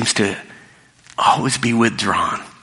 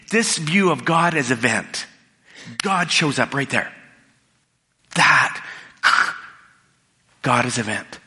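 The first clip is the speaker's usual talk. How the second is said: normal talking voice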